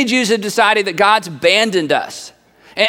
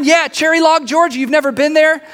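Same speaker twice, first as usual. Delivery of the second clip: much higher, eager and surprised; enthusiastic insistence; 7 semitones higher than usual. Acted. Bearer of news defends Cherry Log, enthusiastic. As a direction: act amazed they don't know the place. Acted eager answer about tiny town.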